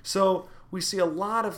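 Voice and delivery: ordinary talk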